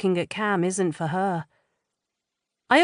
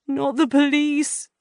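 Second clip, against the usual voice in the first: high, slow, frightened whimper; 9 semitones higher, 4 dB louder than usual. A narrator's reading. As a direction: plead it in a small high voice. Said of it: "he whimpers".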